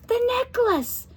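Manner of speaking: high, excited exclamation; a flash of discovery; sudden realization. A narrator's reading. Josie realizes the necklace is the key.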